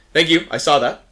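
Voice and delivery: a lightly voiced, keen guard. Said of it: "he goes".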